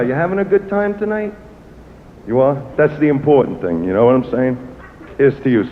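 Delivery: usual speaking voice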